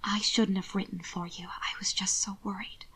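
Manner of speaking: trembling hushed whisper. Acted. Margot whispers her guilt to Hannibal, trembling. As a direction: confess it in a whisper. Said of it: "Margot whispered".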